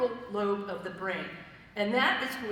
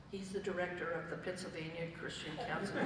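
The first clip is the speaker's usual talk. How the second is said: low, hushed disbelieving thought; quiet, incredulous inner voice; incredulous aside; 4 semitones lower, 9 dB quieter than usual. Retold or imitated. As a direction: mutter it to yourself in mild disbelief, ending in a laugh. Disbelieving thought at counselor's messy office.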